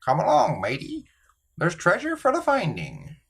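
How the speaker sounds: lightly voiced pirate, high; a hint of a playful pirate. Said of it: "he talked like a pirate".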